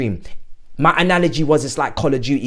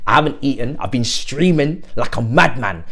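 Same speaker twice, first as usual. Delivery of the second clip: usual speaking voice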